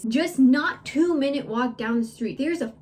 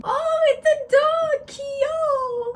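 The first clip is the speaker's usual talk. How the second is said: put-on much higher, slow, delighted child; little kid voice; 3 dB louder, much slower than usual. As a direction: coo like a small boy at a dog. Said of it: "He literally turns around".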